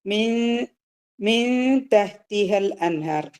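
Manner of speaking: slow tajweed reading of മിൻ തഹ്തിഹൽ അൻഹാർ; ikhfa recitation. Shown as a demonstration. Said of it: "ഇഖ്ഫ ആണ്".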